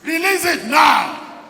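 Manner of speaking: slow decree building to a shout; prayer decree. Shown as a demonstration. Preacher models a decree for the congregation, building to a shout. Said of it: "then you make a decree".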